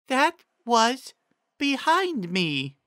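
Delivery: high, slow, simple-minded pony voice; high slow Ditzy. A narrator's reading. Ditzy reading Trixie's riddle to the group, high and slow. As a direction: drag it out high and dopey.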